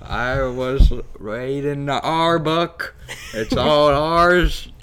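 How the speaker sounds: loud, high hammy pirate. Pretend. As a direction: ham up an emotional pirate with big R's. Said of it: "a emotional pirate".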